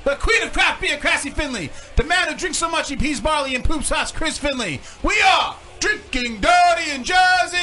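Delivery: booming showman announcer, pitched high; ringmaster. Acted. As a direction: circus ringmaster welcoming the crowd. Introduces hosts as announcer.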